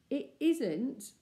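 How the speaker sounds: put-on stern older brother. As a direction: start to rebuke sternly. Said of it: "Robert was beginning sternly".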